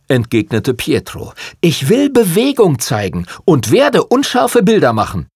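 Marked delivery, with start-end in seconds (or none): 1.6-5.3 heated, high-pitched insistence; rebellious, emphatic announcement; defiant. A narrator's reading. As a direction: declare it stubbornly, pitch raised. Photographer insists against the prince.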